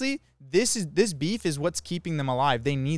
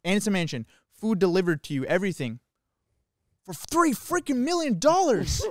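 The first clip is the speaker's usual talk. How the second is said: mock sales pitch; 5 semitones higher, 3 dB louder than usual. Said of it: "He said".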